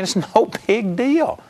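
laughing it off, slow and high. Retold or imitated. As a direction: chuckle through the words. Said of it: "I just was laughing".

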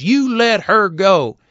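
a healer demanding obedience; commanding order. Retold or imitated. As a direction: stress YOU and lean on every word.

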